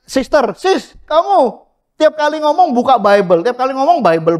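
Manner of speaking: high mocking voice of a complaining friend; mocking quote. Preacher mimics a friend nagging a sister, high and whiny. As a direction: pitch it up and whine about the Bible. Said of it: "ada lagi yang bilang".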